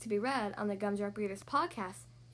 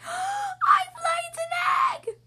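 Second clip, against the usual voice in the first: very high, loud, drawn-out squeal; shriek; 8 dB louder, much slower than usual. A narrator's reading. Marjorie announces her egg to everyone, shrieking. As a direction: shriek the news slowly across the barnyard. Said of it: "shrieked Marge".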